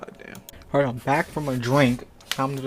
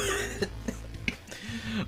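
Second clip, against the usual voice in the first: breathy giggle; 10 semitones higher, 8 dB quieter than usual. Real, not acted. Giggling at the fanfic.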